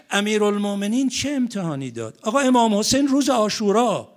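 high, loud rising call to the congregation; impassioned cry. Acted. Preacher crying out to the congregation about Ashura, impassioned and loud. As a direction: cry it out like a climax of mourning.

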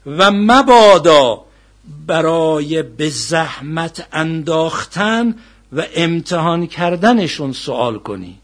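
loud, slow, measured admonition; forceful preaching recitation. Acted. Preacher warning against testing scholars with questions, forceful recitation. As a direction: declaim each phrase slowly and forcefully.

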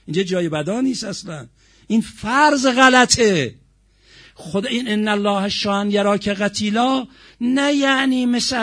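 high, loud sermon delivery; full-force preaching. Real, not acted. Preacher explaining Karbala's purpose to the congregation, preaching at full force.

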